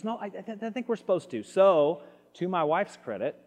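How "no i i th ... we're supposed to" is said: higher-pitched imitation of a wife; wife insisting. Wife answering her ticked husband about helping strangers, lightly mimicked higher voice. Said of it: "She goes".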